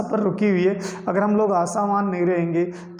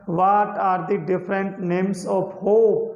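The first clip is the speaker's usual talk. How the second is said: slow dictation; much slower than usual. Shown as a demonstration. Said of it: "simple से question आएगा".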